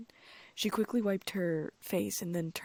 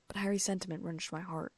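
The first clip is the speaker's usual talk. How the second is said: ordinary delivery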